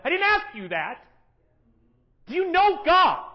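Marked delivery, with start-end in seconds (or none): 2.2-3.2 high, loud, slow challenge; pointed question. Acted. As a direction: press the question home, slowly. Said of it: "pointed question".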